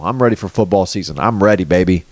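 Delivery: ordinary talk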